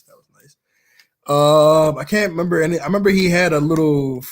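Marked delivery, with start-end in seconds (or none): 0.0-0.6 whispered. Retold or imitated. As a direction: whisper it under the breath. Ends quote in a whisper.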